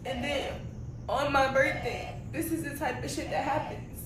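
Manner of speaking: loud, upset rant about her hair; venting loudly through hurt. Real, not acted. Customer on camera ranting about her ruined birthday hair, upset and loud.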